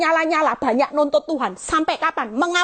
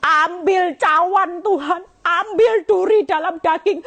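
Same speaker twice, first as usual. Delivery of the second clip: mock crying out; 5 semitones higher than usual. Hurting believer begging God to take the cup, crying out. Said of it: "Kamu sudah teriak-teriak".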